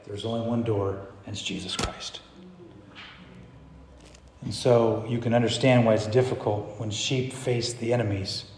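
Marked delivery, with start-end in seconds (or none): 0.1-2.2 much softer, lower statement; quiet conviction. Acted. Preacher voices a true sheep answering the enraged, hushed. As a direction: drop right down for the confession.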